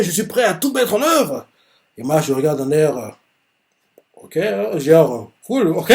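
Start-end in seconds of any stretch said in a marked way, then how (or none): 0.0-1.5 lightly voiced vow. Retelling Domina's vow to his father, lightly voiced. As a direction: say the vow with mild fervour. Same vow retold calmer.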